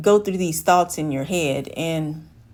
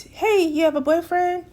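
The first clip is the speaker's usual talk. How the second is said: high, prying and chirpy question; curious coworker voice; 10 semitones higher than usual. Retold or imitated. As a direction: play the nosy woman, pitched way up. Curious woman voiced in story.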